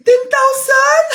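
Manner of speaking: high, slow gasp of disbelief; hammed-up amazement; astonished. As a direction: gasp and cry it out, stunned by the money. Amazed at a large cash gift.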